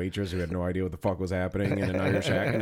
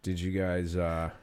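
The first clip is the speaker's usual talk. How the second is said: spaced-out waitress. Pretend. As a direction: act stunned they want food. Imitating stoned oblivious waitress.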